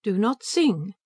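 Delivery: cold, firm order; icy command. A narrator's reading. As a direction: freeze her out with it. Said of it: "she said icily".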